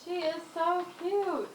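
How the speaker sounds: cooing. Real, not acted. Cooing at girl in film.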